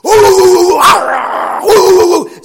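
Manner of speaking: high, loud howling dog noises; weird dog howl. Retold or imitated. Imitating the dog's freaky howl over the fence. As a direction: howl like a strange mutt. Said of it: "it's like".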